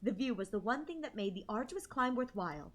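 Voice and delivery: soft, even story reading; story narration. A narrator's reading. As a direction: read it gently like a novel. Reads the game narration.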